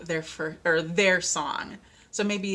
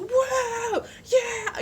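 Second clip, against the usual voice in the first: excited whoop. Retold or imitated. Imitating an enthusiastic churchgoer, high joyful whoop. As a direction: whoop like someone lost in worship.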